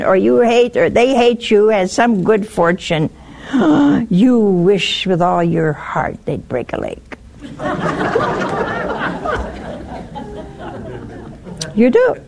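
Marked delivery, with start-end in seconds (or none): 3.5-4.0 mock gasp. Mock shock at rival's fortune. 7.4-11.2 airy high giggle; soft unvoiced chuckle; high breathy laugh. Real, not acted. Congregation-style knowing laugh.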